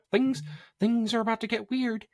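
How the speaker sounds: very high cartoon mouse falsetto; Minnie Mouse. Host spoofs Disney mice getting dark, squeaky mouse impression. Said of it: "That was my mini impression".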